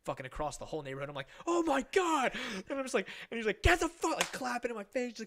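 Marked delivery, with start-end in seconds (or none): 1.5-2.3 high, loud cry of alarm; mock panic. Kid chased across the neighborhood, hammed-up panic. 3.6-4.2 high, loud mock fury; imitated pissed-off yell. Pissed dad clapping in kid's face, imitated fury. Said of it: "Just so fucking pissed".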